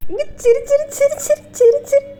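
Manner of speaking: very high, loud chirpy repetitions. Real, not acted. Coaxing baby to smile. (உச்சக் குரலில் குழந்தையைச் சிரிக்க வைத்தல்)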